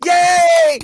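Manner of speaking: high-pitched mock celebration; sarcastic cheer. Complaining about friends like that, high sarcastic cheering. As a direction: whoop with exaggerated fake joy.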